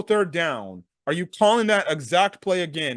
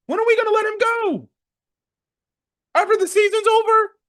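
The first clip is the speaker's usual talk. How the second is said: exasperated squeak; 14 semitones higher, 4 dB louder than usual. Real, not acted. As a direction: pitch shoots way up in disbelief. Exasperated rant about firing coach.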